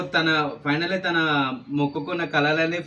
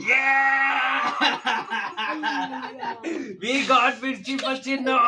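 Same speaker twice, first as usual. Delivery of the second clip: high, slow gleeful cheer; excited cheer; 9 semitones higher, much slower than usual. Real, not acted. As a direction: cheer as if winning a prize. Cheering the chilli draw. (ఉత్సాహంగా అరుపు)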